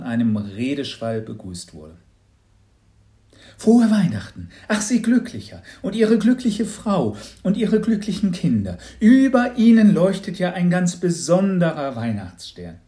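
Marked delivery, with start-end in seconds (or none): 3.6-12.8 high, loud, over-the-top saleswoman voice; a disguised neighbour laying on the cheer; hammed-up gushing congratulations; gushing lottery lady. Disguised neighbour gushes at the door.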